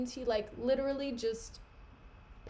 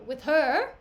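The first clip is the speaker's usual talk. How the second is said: shocked question; 7 dB louder than usual. Acted. Othello shocked at the accusation.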